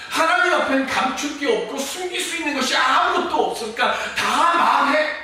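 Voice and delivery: loud, high scolding voice of Job's friends; accusing friends. Acted. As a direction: press him hard to confess, building to a shout on 토설해. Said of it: "친구들은 뭐라고 얘기합니까".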